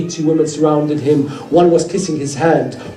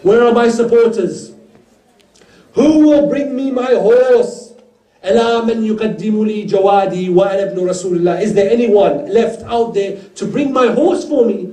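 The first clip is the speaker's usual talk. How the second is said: crying out; 4 semitones higher than usual. Acted. Lone Imam calls across the battlefield for supporters, crying out. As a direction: call out higher, stretching the appeal. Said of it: "He cries out".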